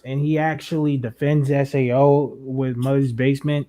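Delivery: ordinary delivery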